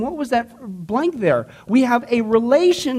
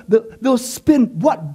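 ordinary talk, nothing marked

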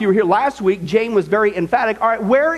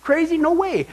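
mock-incredulous, pitched higher; 8 semitones higher than usual. God scoffing at the very idea, incredulous. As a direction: scoff as if it's unthinkable. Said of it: "He said".

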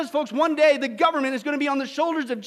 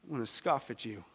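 much lower and much softer scoffing; God scoffing; 14 dB quieter than usual. Acted. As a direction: turn the laugh into cold contempt. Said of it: "his laughter turns".

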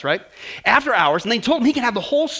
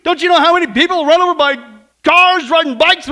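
high, loud alarmed outcry, slowing at the end; panicked boy shouting at the psychologists; crying out; 7 semitones higher, 9 dB louder than usual. Acted. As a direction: yell it like a terrified kid. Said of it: "the negative boy cries out".

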